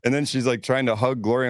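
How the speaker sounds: ordinary talk, nothing marked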